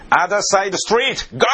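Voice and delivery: high, slow barked English command; cop's loud order. Retold or imitated. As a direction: bark the order like a stern cop. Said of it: "大きな声でこう言いました". (警官の大声の命令)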